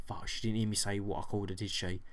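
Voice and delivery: soft, fast worried thought; worried inner thought. Retold or imitated. Shop worker wonders if the customer heard her, soft and fast. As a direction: hush it like she might hear.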